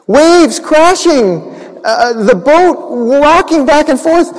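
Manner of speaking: put-on children's story with the storm raging; dramatic storm telling. A narrator's reading. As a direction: make the waves rock in the voice. Storm described dramatically for kids.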